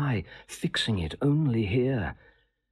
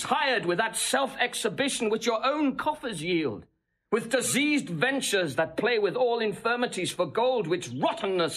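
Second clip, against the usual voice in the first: much higher, heated and insistent; impassioned tirade; 11 semitones higher than usual. Acted. Seducer whips up the princess against her husband, soaring and heated. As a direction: work her up, climbing high with outrage.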